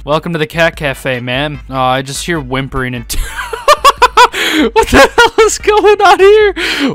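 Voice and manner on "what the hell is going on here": high, slow laughing shout; squealing with laughter, loud; laughing disbelief. Real, not acted. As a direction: crack up in disbelief at the chaos. Shocked laughter at chaos.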